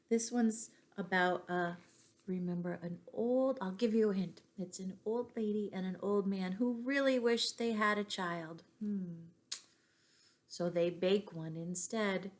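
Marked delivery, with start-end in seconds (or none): none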